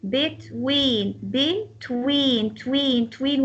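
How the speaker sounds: "Between" broken up, leaning on tween; stress on TWEEN. Shown as a demonstration. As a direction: draw out the second syllable of "Between". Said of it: "will be more emphasized".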